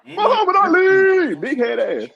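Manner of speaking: high, loud roast; high mocking shout. Real, not acted. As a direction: call it out, cracking up. Roasting game character.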